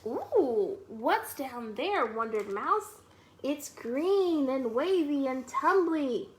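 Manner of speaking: animated, slow storytime read-aloud; lively storytime narration for preschoolers; reading to children. Acted. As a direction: read it like a picture book to little kids. Reading picture book to children.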